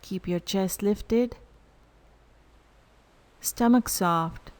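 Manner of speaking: calm guided meditation with long pauses; unhurried, evenly spoken meditation instructions. Real, not acted. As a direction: lead them into stillness, leaving silence between phrases. Leads a breath meditation.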